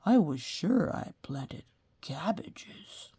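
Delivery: slow hushed whisper; puzzled farmer, whispered slowly; a slow whispered wondering; Farmer Ray's voice. A narrator's reading. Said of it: "said Farmer Ray".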